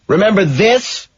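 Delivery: shouting. Acted. Killer showing off his hat, high gleeful shout. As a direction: show it off with a shout. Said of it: "I shout".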